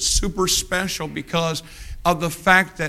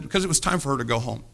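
ordinary delivery

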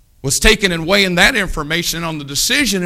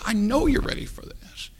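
whisper; 7 semitones higher, 8 dB quieter than usual. Retold or imitated.

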